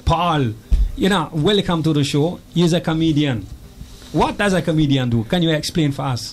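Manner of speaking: put-on Jamaican accent, Jar Jar tinge; thick Jamaican patois, a bit alien. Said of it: "I'm trying to discern your accent".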